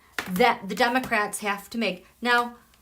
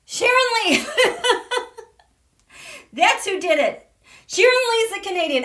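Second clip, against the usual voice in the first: excited realization; 10 semitones higher, 6 dB louder, faster than usual. Real, not acted. Realizing her mistake, excited.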